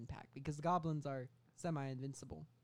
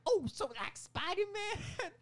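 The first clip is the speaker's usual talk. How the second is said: high, slow, loud cartoon voice; mock boss question; 6 dB louder, slower than usual. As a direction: ask it like a dim cartoon boss. Boss asks in silly voice.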